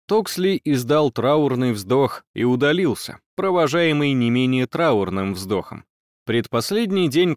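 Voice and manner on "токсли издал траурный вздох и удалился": usual speaking voice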